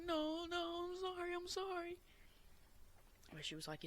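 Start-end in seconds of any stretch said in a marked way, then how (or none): 0.1-2.0 high, crying pleas of sorry; high tearful repeated apology; sobbing apology. Retold or imitated. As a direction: beg forgiveness through tears. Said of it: "I was like".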